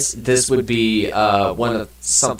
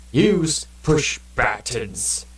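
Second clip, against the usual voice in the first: very slow, put-on voice; slow deadpan mock read; drawn-out mock voice; 3 semitones higher, much slower than usual. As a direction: stretch each word like a joke. Reading a game choice aloud.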